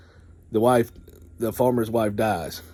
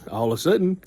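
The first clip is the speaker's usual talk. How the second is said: plain reading voice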